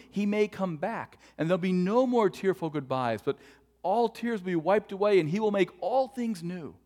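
loud, high, swelling proclamation; impassioned preacher at full voice; full-force gospel preaching. Real, not acted. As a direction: preach it out, building to a ringing promise.

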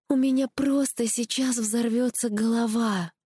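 high, slow exasperated complaint; high-voiced heroine at her limit; overwhelmed. A narrator's reading. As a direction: drag it out as if your head is bursting. Overwhelmed heroine in narrated dialogue.

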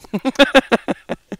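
loud, high laughing fit; laughter. Real, not acted. Amused by a song title, loud laughter.

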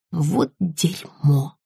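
very slow, drawn-out swearing; long-drawn curse at a setback; dismayed curse. A narrator's reading. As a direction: stretch each word in dismay. Swearing at a padlocked door.

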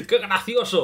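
high chuckle then a laughing exclamation; amused little laugh; demo laugh. Shown as a demonstration. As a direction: show how you laughed alone at the game. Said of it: "No, no una carcajada así".

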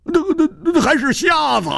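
very high, startled questioning; stutter, then squeak out the question; incredulous high-pitched shock; stammering astonishment. Acted. Astonished at hearing she is blind.

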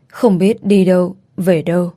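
usual speaking voice

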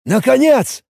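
high, loud exclamation of relief; exclaiming. Acted. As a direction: cry out as if long awaited. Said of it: "воскликнул он таким тоном".

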